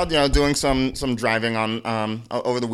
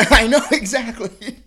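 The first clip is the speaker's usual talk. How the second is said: high, loud burst of laughing agreement; 7 semitones higher, 9 dB louder than usual. Real, not acted. Agreeing with co-host's joke about being dressed, laughing. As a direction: agree through real laughter.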